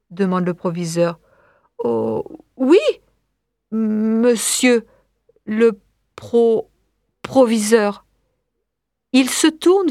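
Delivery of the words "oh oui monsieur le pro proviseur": halting, broken by pauses; frightened stammer. Acted. Scared pupil answers the headmaster, stammering. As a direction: stumble timidly over the title.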